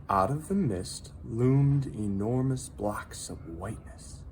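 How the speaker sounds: plain reading voice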